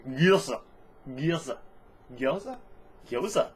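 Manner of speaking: "Gilza" tested word by word, pausing between tries; "Gilza" with a rising, unsure ending on later tries; trying out pronunciations. Shown as a demonstration. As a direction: try "Gilza" several ways, the later ones questioning. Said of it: "I've been told that it's pronounced".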